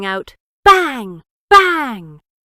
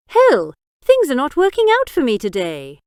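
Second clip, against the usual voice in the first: louder, frustrated exclamation; exclaimed; 5 semitones higher, 7 dB louder than usual. A narrator's reading. Biggs exclaims in dismay to Jack, louder. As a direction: blurt it out in dismay. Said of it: "Biggs then exclaimed".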